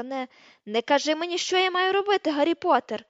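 loud, rising outraged shout; angry yelling. A narrator's reading. Hermione yelling at Harry, loud and angry. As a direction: yell him down furiously. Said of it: "репетувала вона".

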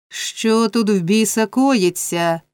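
usual speaking voice

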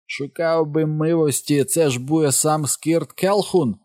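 high, slow, drawn-out caricature; hollering Jim voice. A narrator's reading. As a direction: holler it slowly as a mock servant. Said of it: "голосом нігера Джима".

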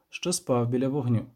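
ordinary delivery, nothing marked

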